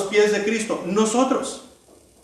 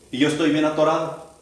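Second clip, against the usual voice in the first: ordinary talk, nothing marked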